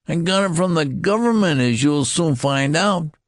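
high, loud defiant boast; small general defying the colonel; defiant boy. A narrator's reading. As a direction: fling it back defiantly.

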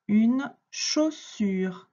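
very slow, spaced-out word presentation; slow dictation. Shown as a demonstration. Teacher dictating a word to a pupil, slow dictation. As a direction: spell it out slowly by ear, word by word.

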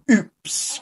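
a soft, high little oops; mild surprised oops. A narrator's reading. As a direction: caught off guard but not hurt. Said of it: "cried Peppa".

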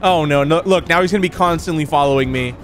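ordinary talk

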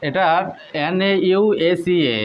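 ordinary talk

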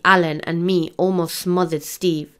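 ordinary delivery, nothing marked

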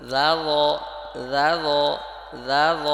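loud, held দা then heavy rounded ল; slow pronunciation drill. Shown as a demonstration. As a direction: separate দা and ল deliberately for learners. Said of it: "আস্তে আস্তে পড়তে হবে".

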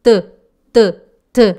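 "t" with a short neutral vowel, "tuh"; voiced t. Shown as a demonstration. Teacher contrasts ways of saying it, "t" as tuh. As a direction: sound out "t" as separate "tuh" beats. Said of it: "Se você fala".

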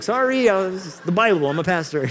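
high, loud mock apology; flustered pastor's excuse. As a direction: plead your excuse high and loud, easing down. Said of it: "You're like".

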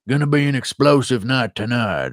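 imitation American Southern voice; American Southern accent. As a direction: do it with a Southern US twang. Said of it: "when I'm doing this accent".

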